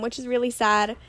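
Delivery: normal talking voice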